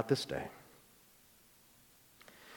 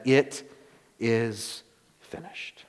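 dying words; 3 semitones lower, 5 dB quieter, much slower than usual. A narrator's reading. Jesus's last words.

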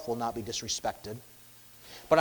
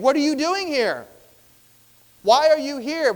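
fearful demon; 12 semitones higher, 11 dB louder than usual. Acted. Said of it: "asking this of Christ in fear".

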